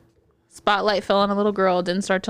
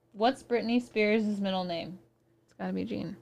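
quiet aside; 10 dB quieter than usual. Real, not acted. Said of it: "Hey, Siri".

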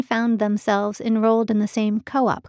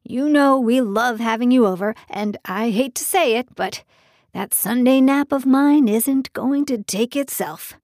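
lightly voiced fatherly man; a hint of a hearty dad; older father voice; 4 semitones higher, 5 dB louder than usual. Carl voiced by narrator.

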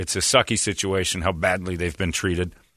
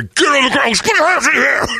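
very high, loud imitated yell; shouted police command; 7 dB louder than usual. Officer startling him from behind the lights, imitated scream. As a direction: scream it like a sudden arrest. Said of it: "and you hear".

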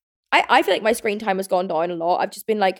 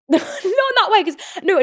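laughing, high and louder, "Not in that way" broken up by laughter; laughing correction; 10 semitones higher, 3 dB louder than usual. Real, not acted. She laughs, correcting how her words sounded. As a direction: laugh through "Not in that way" at her own wording. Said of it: "'cause that sounds like me".

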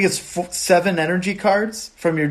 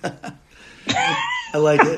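squealed high through laughter; laughing squeal; much slower than usual. Real, not acted. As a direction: squeal it while cracking up.

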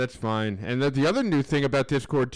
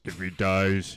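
Drago line; 6 semitones lower, much slower than usual. Pretend.

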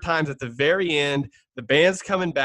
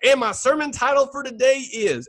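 high-pitched mock preacher announcement; imitating preachers; 9 semitones higher, 3 dB louder than usual. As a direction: proclaim it like a pastor unveiling his title. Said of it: "both of these guys are like".

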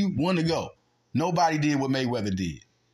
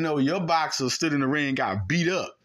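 normal talking voice

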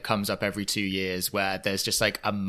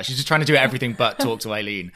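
ordinary talk

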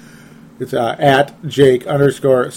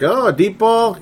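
mock surprise; 9 semitones higher than usual. Mocking Ponder's throw.

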